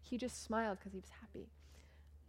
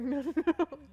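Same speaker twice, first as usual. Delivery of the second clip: loud, high panicked no; loud mock panic; mock refusal; 6 semitones higher, 10 dB louder than usual. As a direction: push the offer away loudly. Refusing the tattoo.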